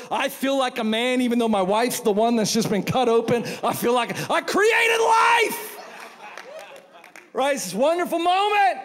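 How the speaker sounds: high, loud, proud shout; husband boasting after the birth, shouting high; triumphant. Acted. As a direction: crow about creating life.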